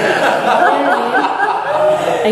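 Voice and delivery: squeaky high laugh through the words. Real, not acted. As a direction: laugh it out at the crowd. Laughing aside to the audience.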